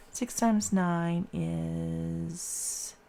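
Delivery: drawn out slowly while working out the sum; slow, stalling. Real, not acted. Teacher stalls on six times nine, drawn out and slow. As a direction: stretch each word while thinking it through. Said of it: "oh, goodness".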